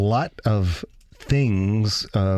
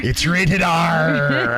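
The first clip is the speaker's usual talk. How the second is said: pirate voice; 6 semitones higher, 3 dB louder, much slower than usual. Pretend. Playing pirate, slow drawn out.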